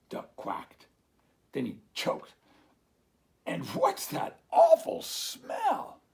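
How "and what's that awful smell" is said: high, slow, loud squawk of disgust; duck's disgusted cry. Acted. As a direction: choke out your disgust. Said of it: "Dog said".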